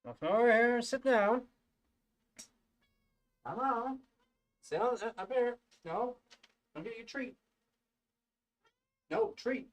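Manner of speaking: high sing-song voice for the cat. Real, not acted.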